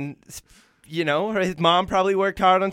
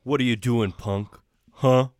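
tough guy; 7 semitones lower, slower than usual. Acted. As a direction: growl a challenge low in the throat. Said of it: "Sylvester Stallone".

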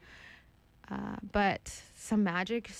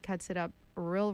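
ordinary delivery

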